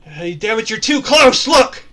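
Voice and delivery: loud, high shout from the lookout; full-volume alarm call; yelled warning. Acted. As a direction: holler it urgently across the ship. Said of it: "He yells out".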